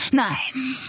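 hoarse, whispery croak. A narrator's reading. Attacker realising she is dying, croaked denial. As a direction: croak it out in disbelief. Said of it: "krächzte Coles".

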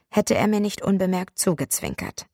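ordinary delivery, nothing marked

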